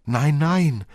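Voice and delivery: high, loud, distressed refusal; acted weeping protest, pitched well up; tearful cry. A narrator's reading. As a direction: cry out in protest as an upset little girl. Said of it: "rief Marie weinend".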